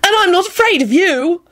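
put-on high, defiant boyish cry; triumphant. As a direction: taunt the demon proudly.

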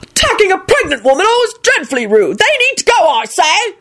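high, swooping indignation; yelled outrage. A narrator's reading. Tall bearded wizard denouncing the warlocks, yelled. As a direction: proclaim it to the crowd, pitched high. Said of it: "yelled a very tall wizard".

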